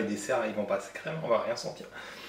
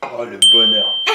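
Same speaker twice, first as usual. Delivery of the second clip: blissful; 9 dB louder, slower than usual. Real, not acted. Relief tasting cinnamon soda.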